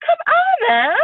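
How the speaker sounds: high, incredulous cry; exasperated exclamation. Real, not acted. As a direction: come on, really. Exasperated outburst.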